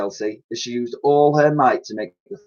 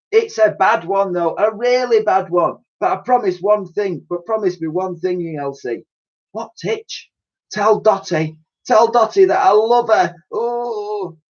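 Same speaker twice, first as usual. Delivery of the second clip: heroic tone; 9 semitones higher than usual. Acted. Titch dramatically urging friends to leave, hammed-up heroic. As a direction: ham up the noble sacrifice.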